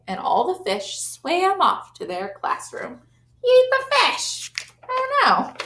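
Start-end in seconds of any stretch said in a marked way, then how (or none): 3.4-5.5 panicked cry. A narrator's reading. Children's panic inside story.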